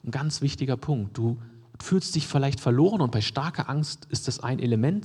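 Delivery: ordinary talk, nothing marked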